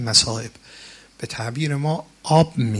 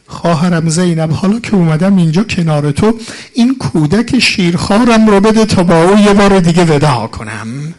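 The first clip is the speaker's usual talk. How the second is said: loud, high wailing sermon chant; mourning lament; 6 semitones higher, 11 dB louder than usual. Acted. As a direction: cry out the martyr's plea to the crowd. Voicing Hussain to Zaynab.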